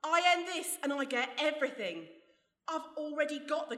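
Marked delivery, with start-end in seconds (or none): 0.0-2.0 gloating mockery. Alice mocking Legs before shooting him, gloating. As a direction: laugh at his threat.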